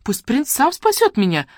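high-pitched, protesting princess; surprised, lifted high in pitch; indignant surprise. A narrator's reading. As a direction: protest in rising disbelief. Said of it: "удивилась Вася".

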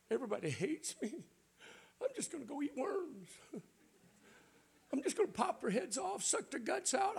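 hammed-up high sulky moaning; whining complainer.

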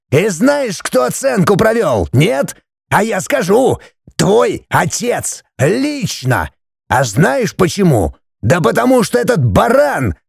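high-pitched, strained accusing tirade; wrathful. A narrator's reading. Father blaming his son for the fine, wrathful. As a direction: punch out each question angrily. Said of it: "отец гневно указал пальцем".

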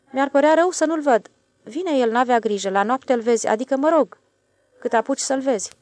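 a plain railway man shrugging off worry; switchman's voice. A narrator's reading. The switchman reassures the stationmaster, lightly voiced.

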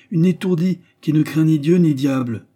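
cri aigu et fort; s'exclamer d'une voix bien plus haute et forte; s'écrie. A narrator's reading. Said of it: "s'écria Verdier". (le père s'écrie, plus haut et plus fort)

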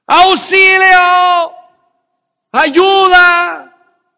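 desperate shout for help. Retold or imitated. Trapped under the truck, screaming for a neighbor, desperate full shout. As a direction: yell for help with everything you have. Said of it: "con todas las fuerzas de mi pulmón".

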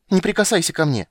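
put-on high, loud child's squeal; shrieking. A narrator's reading. Girl orders the witcher not to touch her, shrieking. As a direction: shriek it like an outraged little girl. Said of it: "взвизгнула она".

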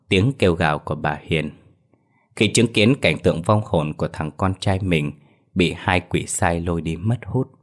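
ordinary delivery, nothing marked

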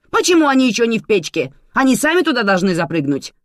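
high, loud nagging rant; angry scolding. A narrator's reading. Manana berates Lola for the waiting khachapuri, scolding loudly. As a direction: scold with outraged rhetorical questions.

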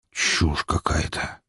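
low, soft, slow grumble; irritated mutter. A narrator's reading. Executive dismissing an unwelcome visitor's claim, irritated mutter. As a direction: mutter it under your breath, irritated. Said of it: "раздражённо пробормотал Шугарин".

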